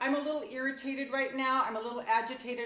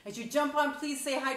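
ordinary talk

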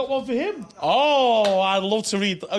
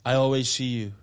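love voice; 6 semitones lower than usual. Pretend. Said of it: "a love voice".

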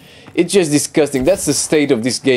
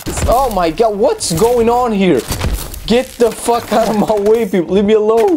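high, panicked yelling; frantic high-pitched outburst; 8 semitones higher than usual. Real, not acted. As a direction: shout at the crowd to get away. Swarmed by enemies.

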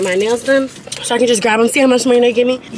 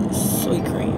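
hushed whisper, slow; 5 dB quieter than usual. Real, not acted. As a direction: breathe it out softly. Savoring the drink, hushed.

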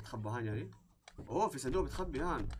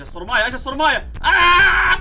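2 building from a loud call to a piercing high scream; 3 panic louder until you shriek; 4 a worker screaming as the boss arrives; 1 rising panicked scream; 13 semitones higher, 17 dB louder than usual. Real, not acted.

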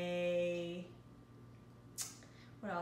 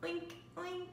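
high, soft piggy oink, played for baby; 8 semitones higher, 6 dB quieter than usual. Mother oinking like a pig for her toddler, high and soft. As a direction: squeal it like a cartoon pig for the toddler. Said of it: "What does the pig say?".

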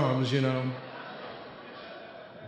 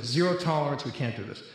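normal talking voice

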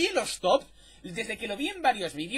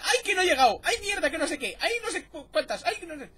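high, loud whining "ay" complaints; mock his moaning; whiny complaining friend; 8 semitones higher, 3 dB louder than usual. Streamer mocking his whining teammate, high whiny complaints. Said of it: "no hacía más que quejarse en plan".

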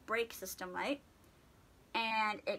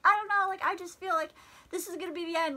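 tearful; 7 semitones higher, faster than usual. Retold or imitated. Crying on phone to mom.

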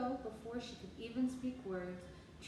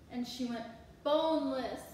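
ordinary delivery, nothing marked

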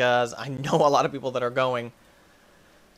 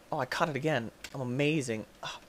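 usual speaking voice

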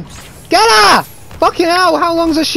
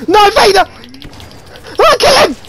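triumphant yelling; 7 semitones higher, faster than usual. Real, not acted. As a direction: celebrate the kill at full voice. Gamer kills boss, triumphant.

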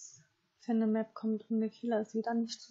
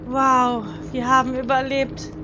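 excited relief; 8 dB louder than usual. Real, not acted. Relief after surviving.

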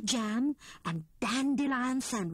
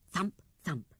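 ordinary delivery, nothing marked

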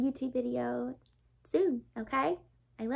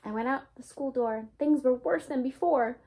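lively storybook read-aloud, bouncy and bright; reading to children. A narrator's reading. Teacher reading a Dr. Seuss book to her class, lively read-aloud. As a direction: read it like story time for little ones.

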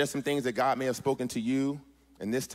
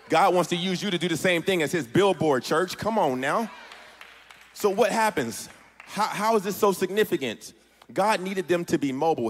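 higher, raised preacher's voice; impassioned preaching; 5 semitones higher, 4 dB louder than usual. Real, not acted. Preacher urging the church to be God's billboard, impassioned. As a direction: preach it out to the whole room.

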